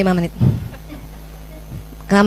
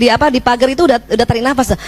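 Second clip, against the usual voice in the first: normal talking voice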